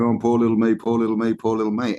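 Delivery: a hammed-up sulky moan, fading off at the end; mock self-pity whine. Someone on the couch before Netflix pitying themselves, a mock whine. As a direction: mimic a sulker feeling sorry for themselves. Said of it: "in front of Netflix going".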